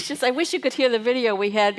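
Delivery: ordinary delivery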